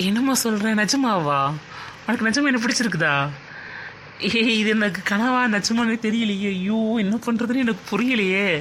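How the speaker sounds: overjoyed disbelief. Acted. Boy hearing her confess love, stunned and thrilled. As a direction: exclaim as if it might be a dream.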